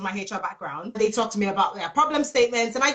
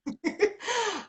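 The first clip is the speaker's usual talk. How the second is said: laugh; 10 semitones higher, 7 dB quieter than usual. Real, not acted. Laughs after own joke.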